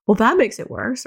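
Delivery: lightly mocking child retort; snarky kid. Ten-year-old snarking at mom's pep talk, mild mock sarcasm. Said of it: "who's a little snarky sometimes".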